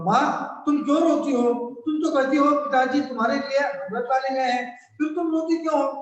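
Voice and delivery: put-on a boy asking his crying mother why; child Rahul. Rahul asks his weeping mother why she cries, high child voice. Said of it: "Rahul".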